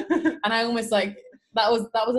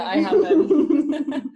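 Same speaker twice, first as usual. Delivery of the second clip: laughing; 8 semitones higher, 8 dB louder, much slower than usual. Real, not acted. Laughing about the gold medal.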